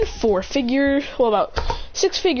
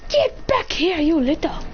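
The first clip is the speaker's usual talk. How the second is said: much higher, snapping at him; mock angry yell; 7 semitones higher, much slower than usual. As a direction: chase him off in a fake rage. Angry chasing.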